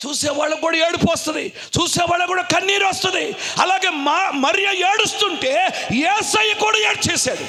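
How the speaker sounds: high, driving sermon shout; full-force preaching. Real, not acted. Preacher telling how Jesus wept with Mary, impassioned. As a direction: press each phrase out high and urgent.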